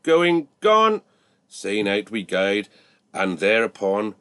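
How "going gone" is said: loud, high hammer call; auctioneer's shout. Acted. Auctioneer shouting the sale as the hammer falls, loud. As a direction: bring the hammer down with a cry. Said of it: "as Lovett shouted".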